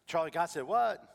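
stunned disbelief. Retold or imitated. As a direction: ask it as if you can't believe it. Astonished reply on phone.

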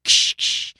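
two voiceless jingle noises; imitated sleigh bells. Host corrects the bell sound, hissed jingle imitation. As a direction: shake out sleigh bells with the mouth. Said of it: "I don't have the sleigh bells".

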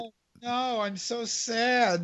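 slow, soft pretend heartbreak; mock sad. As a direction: drag it out quietly, feigning sorrow. Mock reaction to losing award.

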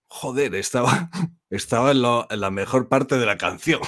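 loud mock outrage through laughter; laughing complaint. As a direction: complain while cracking up. Quoting an annoyed user while laughing.

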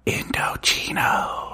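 slow soft whisper in the ear; gentle whisper. Pretend. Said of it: "he'll gently whisper in your ear".

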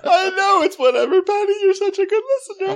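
very high, loud whiny sobbing; drunk crying. Retold or imitated. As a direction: blubber at your friend. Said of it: "basic bitch crying in the backseat".

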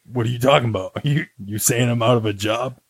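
Ernie. Acted. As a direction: put on an offended Brooklyn accent. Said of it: "kind of like a Brooklyn accent".

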